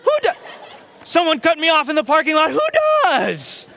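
very high put-on voice, punchy; imitating Riz. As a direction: mimic a friend's clipped slang. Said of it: "he would say something to the effect of".

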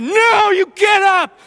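high, loud, slow yell; wife shouting at her husband to move. Retold or imitated. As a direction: order him up at full volume. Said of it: "She said".

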